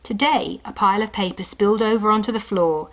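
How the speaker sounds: ordinary delivery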